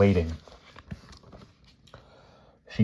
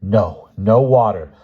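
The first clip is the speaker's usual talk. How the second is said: loud, slow, drawn-out protest; loud alarmed plea; panicked refusal; 3 semitones higher, 7 dB louder, slower than usual. A narrator's reading. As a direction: cry out to refuse. Lynn refusing the water in panic.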